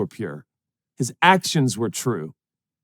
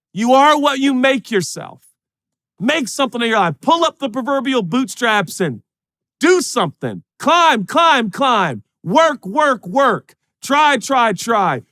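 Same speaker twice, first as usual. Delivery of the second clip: football coach pep talk; 10 semitones higher than usual. Retold or imitated. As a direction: drive the players like a Friday night coach.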